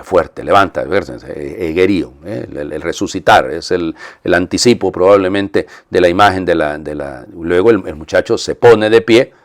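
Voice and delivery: normal talking voice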